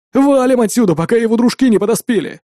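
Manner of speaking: rally the gang to run; urgent gang boss. Acted.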